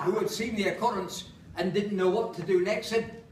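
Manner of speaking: lightly northern Lancashire lilt for a comic recitation; Lancashire accent. Acted. Performer reciting Holloway monologue to audience, Lancashire accent. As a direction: recite it in broad Lancashire English. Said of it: "so I have to do it in an accent".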